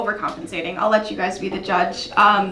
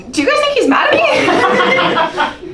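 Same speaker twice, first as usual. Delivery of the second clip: laughing plea; 12 semitones higher, 4 dB louder, slower than usual. Real, not acted. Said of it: "laughing plea to audience".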